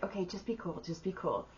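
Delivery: hushed inner pep talk. Retold or imitated. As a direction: quietly psych yourself up. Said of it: "in my head".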